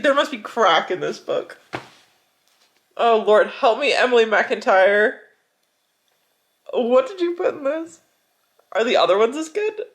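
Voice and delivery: ordinary talk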